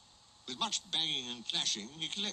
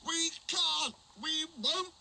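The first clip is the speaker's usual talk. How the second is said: much higher shouted truck refusal; stubborn trucks; slower than usual. Acted. The trucks refusing Diesel, high and stubborn.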